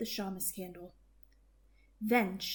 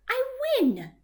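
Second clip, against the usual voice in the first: high, loud triumphant cry; gleeful cheer; 7 dB louder than usual. A narrator's reading. Baba Brina winning the dreidel game, cheering. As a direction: crow over the win.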